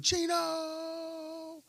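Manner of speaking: high, drawn-out cheer of the name; fan yell. Retold or imitated. The same trucker cheering his name at the game.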